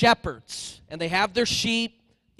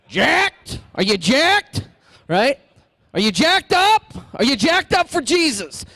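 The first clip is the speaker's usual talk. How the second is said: high, loud rising questions; hyped-up call; 8 semitones higher, 8 dB louder than usual. Acted. Teacher hyping the congregation about Jesus, loud and high. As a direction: pump the crowd up like a coach. Preacher rousing youth-style excitement.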